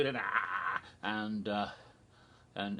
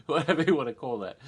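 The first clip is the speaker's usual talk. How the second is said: laughing; 3 semitones higher, 8 dB louder, faster than usual. Real, not acted. Laughing at own joke.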